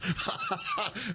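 soft burst of chuckles; laugh. Real, not acted. Host laughs at his joke on the name, chuckling. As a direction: chuckle helplessly mid-sentence.

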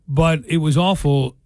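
ordinary talk, nothing marked

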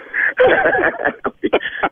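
high, airy laughter; breathy laugh. Real, not acted. Singer laughing at the radio prankster, wheezing.

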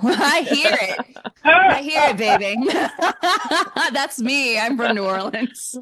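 high, delighted talk through laughter; laughing. Real, not acted. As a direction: laugh as you claim the hometown. Amused at caller's familiar accent.

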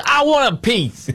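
high-pitched, drawn-out mock cry; slow, squeaky imitation of an eager audience member; mimicked crowd member. Host mimics the one person in the crowd, high mocking cry. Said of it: "person in it says".

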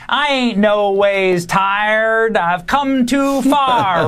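slow, drawn-out imitation of Hillary's Southern church accent; mock her put-on Southern accent at a crawl; mock Southern preacher accent. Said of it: "Hillary Clinton famously does this".

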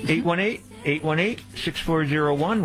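number read over music with a high rising tune; bright radio bumper read; upbeat station-break announcement; announcer read. Acted.

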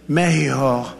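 Mexico with a Spanish "h" sound, like Meh-hee-ho. Retold or imitated. Imitating Mexico's president saying Mexico the Spanish way, Meh-hee-ho. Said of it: "and she calls it".

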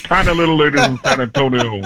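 laughing Barkley voice; Barkley line through a laugh; Charles Barkley. Shown as a demonstration. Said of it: "the Charles Barkley".

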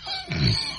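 soft breath of laughter; real suppressed laughing fit; stifled laugh. Real, not acted. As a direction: lose it quietly after the line. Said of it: "I didn't mean to laugh".

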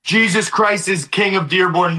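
ordinary talk

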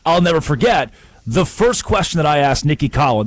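ordinary delivery

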